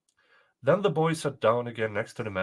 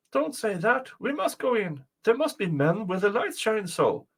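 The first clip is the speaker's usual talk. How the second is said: put-on high-pitched, urging boy; insistent boy; 8 semitones higher than usual. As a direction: urge her on in a higher voice. Boy urges mother inside.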